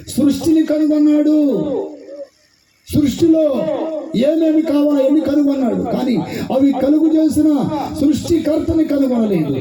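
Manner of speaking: high-pitched, ringing sermon delivery; forceful preaching. Real, not acted. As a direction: preach it out to the whole congregation.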